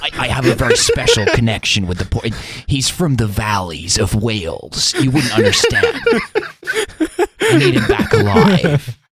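John Wick voice. Pretend. Keanu as John Wick, with a dolphin.

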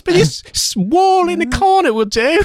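falsetto hammed-up Norfolk woman; Norfolk accent. Replying to the suitor, falsetto Norfolk lass. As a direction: purr it high like a cheeky Norfolk lass. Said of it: "I don't know if that's a Norfolk accent".

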